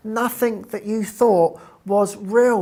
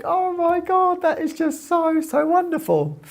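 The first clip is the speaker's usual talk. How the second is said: much higher, gushing delight; thrilled; 10 semitones higher than usual. Retold or imitated. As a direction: coo with wonder. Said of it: "And I'm like".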